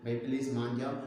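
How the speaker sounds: low, falling plea, "भाई please मान जाओ"; falling intonation. Shown as a demonstration. Teacher demonstrates pleading with a brother, falling intonation down through "मान जाओ". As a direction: let "मान जाओ" drop low at the end. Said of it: "This is falling intonation".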